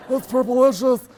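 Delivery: put-on high, loud, slow nerdy teen drawl; Napoleon Dynamite impression. Dad comparing daughter's word to a movie, loud high slow drawl. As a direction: stretch it out like a deadpan awkward teen. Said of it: "Is that Napoleon Dynamite right there".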